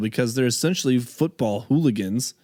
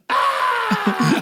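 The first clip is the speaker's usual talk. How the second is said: high, loud mocking "Ah!"; loud jeering cry of a bar crowd; mock group yell; 3 dB louder than usual. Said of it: "the entire bar goes".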